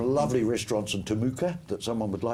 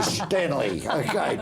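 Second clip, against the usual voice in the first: ordinary delivery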